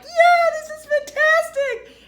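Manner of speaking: very high, loud, drawn-out whoop; exaggerated ecstatic yell; mock thrill. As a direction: squeal like a delighted roller coaster rider. Imitating thrilled roller coaster rider.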